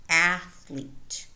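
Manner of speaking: ATH-lete, first syllable of "athlete" prominent; stress on first syllable. Shown as a demonstration. Teacher pointing out stress in "athlete", first syllable stressed. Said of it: "The stress falls on the first syllable".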